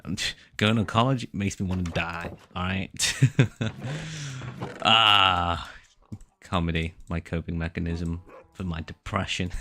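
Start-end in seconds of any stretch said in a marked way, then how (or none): 3.2-4.8 high giggle. Real, not acted. Laughing at own joke.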